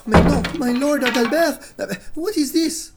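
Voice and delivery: high, loud shocked outcry; shocked French exclamation. Acted. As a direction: gasp in horror at the mess. Shocked at messy workshop.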